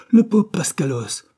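urgent high cry; high, loud answer. A narrator's reading. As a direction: fling the name out high and loud. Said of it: "Robertson répondit".

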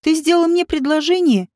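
ordinary delivery, nothing marked

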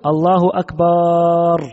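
"أكبر" said as "أكبار" with a long a after the b, very slowly; wrong Akbaar. Shown as a demonstration. Teacher demonstrating the forbidden long aa in "أكبر", very slow. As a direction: stretch the b-vowel of "أكبر" into a long aa. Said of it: "صورتها أن يقول".

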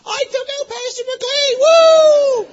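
put-on high, triumphant boy's whoop, loud at the end; small kid bursting with pride after the tackle; overjoyed kid cheer. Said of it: "he was so happy".

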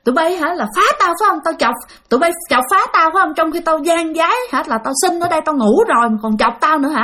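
retold angry scolding. Father raises his paddle at the ghosts, angry scolding. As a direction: confront the ghosts, fed up and fuming. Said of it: "bà nói".

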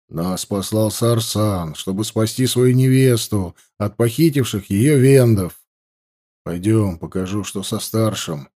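put-on a stern young warrior, low; низкий голос воина. Narrator voices the warrior Nakh. (суровый воин, ниже и медленнее)

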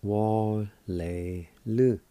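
"Wa-le-lu" drawn out slowly, each syllable "Wa", "le", "lu" separated. Shown as a demonstration. Teacher slowly models "Wa-le-lu" for students, syllable by syllable. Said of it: "It's pronounced like this".

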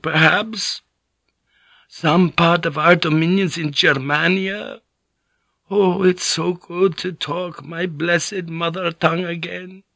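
put-on a frail old monk, relieved then bitter; relieved old priest.

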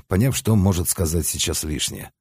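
usual speaking voice

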